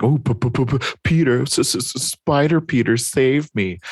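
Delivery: high, exaggerated stutter mocking the victim; Hobgoblin mocking stammer. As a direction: mimic a helpless plea, tripping on the first sounds. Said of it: "And Hobgoblin's like".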